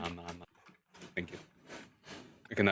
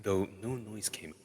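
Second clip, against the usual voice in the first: usual speaking voice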